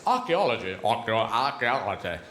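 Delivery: "archaeology" in a series of low regional British accents; changing regional accents. Shown as a demonstration. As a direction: shift regional accent on each repeat of the word. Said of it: "As you go around the country".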